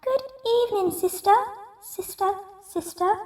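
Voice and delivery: very high duck calling into the cave. Acted. Duck calling her sister at the cave mouth. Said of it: "she quacked".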